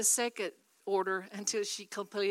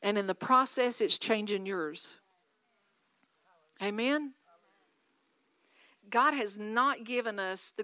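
louder, ringing preacher's call to the church; preaching at full force; 6 dB louder than usual. Acted. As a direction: drive each line home to the congregation. Preacher exhorting the congregation.

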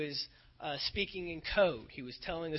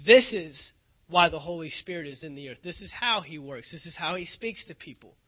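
preaching litany; 6 dB louder than usual. Acted. Voicing the kingdom message, repeated this is.

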